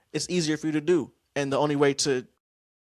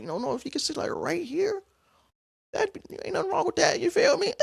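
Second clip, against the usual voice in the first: high put-on flirty voice; a teasing high-pitched flirt; flirty impression. Showing friends how he invited her to sit, flirty falsetto. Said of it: "So I'm saying".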